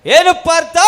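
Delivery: loud theatrical challenge; stagey, forceful prompt; epic stage dialogue. Shown as a demonstration. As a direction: declaim it to Partha, loudly. Redemonstrates the epic line loudly.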